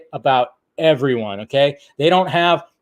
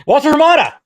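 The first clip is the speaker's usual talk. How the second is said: called out loud; 12 semitones higher, 7 dB louder, faster than usual. Acted. Calling out names for a drop.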